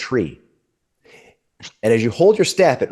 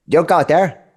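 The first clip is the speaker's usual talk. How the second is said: worried squirrel; 6 semitones higher, 3 dB louder than usual. Acted. Squirrel warns again.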